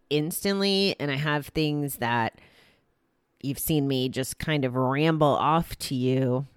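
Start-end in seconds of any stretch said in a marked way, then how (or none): none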